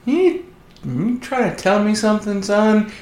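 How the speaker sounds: lightly voiced movie dad, mock suspicion; imitating the father. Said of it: "Mel Gibson goes".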